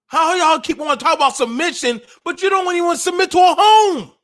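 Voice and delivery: building to a shout. Real, not acted. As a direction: build the challenge until you yell it at the crowd. Rhetorical challenge to the audience.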